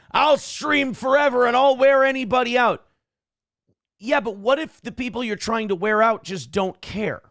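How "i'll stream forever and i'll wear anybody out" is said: mock boast. Mocking the rival streamer's attitude.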